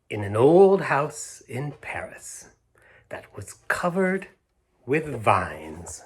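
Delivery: slow, lilting read-aloud with hushed pauses; storybook reading. A narrator's reading. Storyteller reads the opening rhyme to children slowly. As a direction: savour each rhyming line for little listeners.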